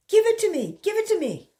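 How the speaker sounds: high, loud insistent demand. Acted. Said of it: "Terence demanded".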